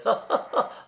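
high, light giggle; acted high-pitched laugh; cook's laugh. A narrator's reading. Cook laughs at the imitation.

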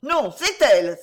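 put-on high, loud child's protest; sudden loud outburst; indignant cry. A narrator's reading. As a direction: cry out in denial like an accused little boy. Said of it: "s'écria Friquet".

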